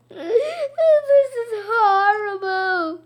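slow, high hammed-up wail; mock sobbing. A narrator's reading. Teacher caught out, hammed-up slow sobbing wail. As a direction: wail it like a cartoon weep. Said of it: "Missus Rupee said".

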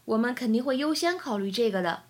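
usual speaking voice

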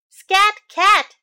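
a dog chasing off a cat; loud, sharp shoo; loud dog shout. Acted.

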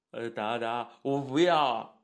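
put-on thick childlike whine of refusal; mild balky roar. A narrator's reading. A-Xiong refuses Coach Yang, balky growl. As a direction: balk like a big simple child. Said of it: "阿熊仔咆哮道".

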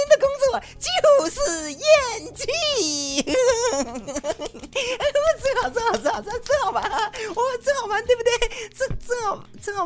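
high, laughing gloat; laughing through words. Real, not acted. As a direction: gloat while still laughing. Laughing at own punchline.

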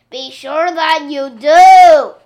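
slow booming, very loud; storm spirit. Acted. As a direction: thunder it out like a storm. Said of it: "boomed the wind".

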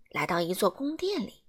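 plain reading voice

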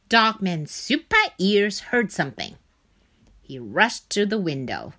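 ordinary delivery

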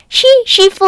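high, loud chirpy yes; eager apprentice. Acted. Chick answers the master baker, high and chirpy. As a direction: answer like a keen little helper.

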